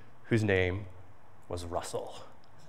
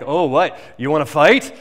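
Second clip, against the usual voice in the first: louder, taunting dare; a sixth grader daring the little kid; bully challenge; 6 dB louder than usual. Retold or imitated. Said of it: "He's like".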